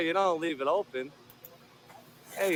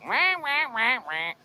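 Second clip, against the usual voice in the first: mock crybaby wail; 7 semitones higher than usual. Mocking a man as crybaby.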